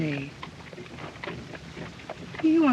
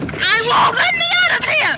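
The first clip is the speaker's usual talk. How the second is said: loud, very high yell; defiant shouting; 11 semitones higher, 5 dB louder than usual. Acted. Passenger defies abductor.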